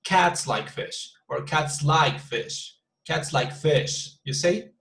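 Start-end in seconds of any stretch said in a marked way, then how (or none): 0.0-1.1 slow, Cats stressed at the front; Betonung auf CATS. Shown as a demonstration. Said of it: "three different intonations". 1.4-2.8 slow, like stretched and stressed; Betonung auf LIKE. Shown as a demonstration. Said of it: "three different intonations". 3.0-4.1 slow, weight landing on fish; Betonung auf FISH. Shown as a demonstration. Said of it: "three different intonations".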